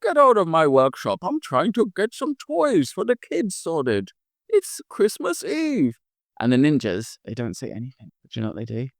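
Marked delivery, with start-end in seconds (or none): 0.0-5.9 character voice. Acted. Angry figure shooing visitors off.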